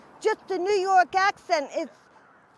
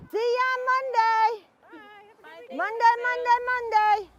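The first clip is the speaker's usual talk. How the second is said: calling out goodbye; 5 semitones higher than usual. Real, not acted. Calling goodbye to kids across road.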